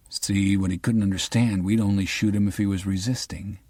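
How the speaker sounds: plain reading voice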